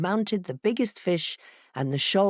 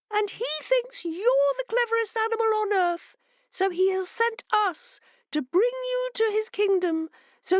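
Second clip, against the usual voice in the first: much higher, stammering fish voice; nervous fishes. A narrator's reading. Fishes tricking the fox with a story, high and nervous. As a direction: fumble the words like a poor liar.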